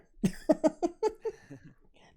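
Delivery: high-pitched burst of laughter; a long, high laugh that tapers off; real high laugh. Real, not acted. Joking at the table.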